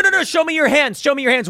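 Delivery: high, urgent mock police order; acted police command. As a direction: bark it like an alarmed officer. Acts police shouting commands at arrestee.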